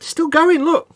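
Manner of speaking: high, loud alarmed call to look; pointing out his bleeding cut with alarm; excited. Real, not acted. Showing his friend the still-bleeding cut, excited and loud.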